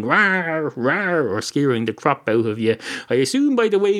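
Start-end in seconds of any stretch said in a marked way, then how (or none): 0.0-1.3 lion rawr. Retold or imitated. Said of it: "wasn't going".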